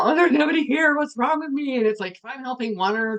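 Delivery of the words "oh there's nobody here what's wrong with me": high, loud, mock-panicked whine; insecure inner voice. Mocking her old self over an empty stream, high and loud. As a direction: play your old self, hurt that nobody came. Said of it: "take it really personal".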